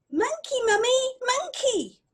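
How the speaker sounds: put-on high, loud childlike chant; the child thanking its mummy, in a high childlike voice; happy child. A narrator's reading. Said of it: "it looked at me and it said".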